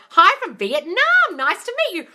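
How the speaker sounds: excited greeting. Real, not acted. Greeting the first viewer from Vietnam, thrilled. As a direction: beam a big hello.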